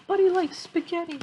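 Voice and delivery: plain reading voice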